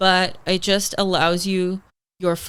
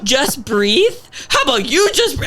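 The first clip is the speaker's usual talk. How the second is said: higher, louder mocking retort; mock angry retort; 6 semitones higher, 5 dB louder than usual. Snapping back at the nurse, louder and mocking. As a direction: throw the nurse's words back at him. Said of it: "I was so mad".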